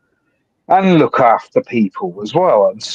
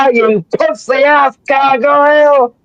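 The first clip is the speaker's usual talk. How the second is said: high, loud jeering yell; mocking shout; 13 semitones higher, 3 dB louder, slower than usual. Real, not acted. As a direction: shout him down with a laugh. Taunting someone joining the stream.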